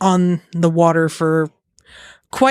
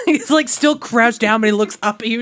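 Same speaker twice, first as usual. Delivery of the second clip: ordinary talk, nothing marked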